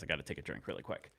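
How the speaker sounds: normal talking voice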